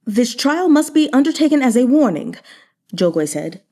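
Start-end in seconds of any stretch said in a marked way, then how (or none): 0.1-2.3 firm declaration. A narrator's reading. Zhou Gui declares trial a warning.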